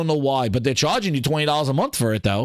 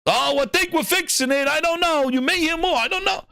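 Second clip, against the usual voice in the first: mock VP; 9 semitones higher than usual. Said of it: "Send out the new V P of Next Gen".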